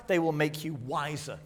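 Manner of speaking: usual speaking voice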